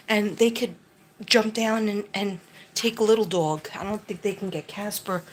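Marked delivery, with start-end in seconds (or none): none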